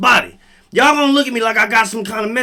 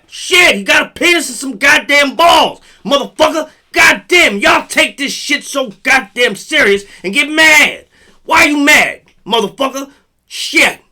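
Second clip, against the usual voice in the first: loud, higher heated rant; worked-up comic tirade, shouting; 6 semitones higher, 8 dB louder than usual. Real, not acted. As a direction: yell it like you're fed up. Heated rant about assigned sex.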